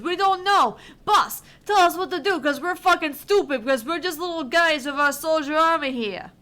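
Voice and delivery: hammed-up mob henchman; Italian henchman voice. Henchman reports to the boss in a hammed-up Italian accent. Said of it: "do Hamza in, like, an Italian voice".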